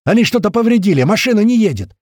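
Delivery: high, loud panicked report; desperate cry. A narrator's reading. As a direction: shout in despair from the driver's seat. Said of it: "полный отчаяния крик Рыжего". (отчаянный громкий крик)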